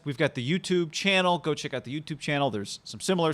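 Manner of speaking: ordinary talk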